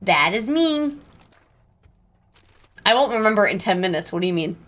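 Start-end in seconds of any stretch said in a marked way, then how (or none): none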